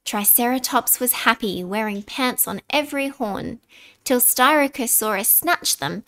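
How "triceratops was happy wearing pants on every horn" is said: ordinary delivery, nothing marked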